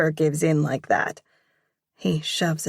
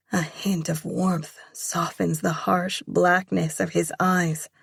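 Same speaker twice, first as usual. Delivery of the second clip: usual speaking voice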